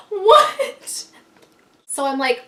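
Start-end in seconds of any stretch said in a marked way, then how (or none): 0.1-1.1 high, loud laughing disbelief; a stunned yelp with a laugh; shocked what. Retold or imitated. Narrator reacting to the neighbor's news, laughing shock. Hearing the girl peed inside.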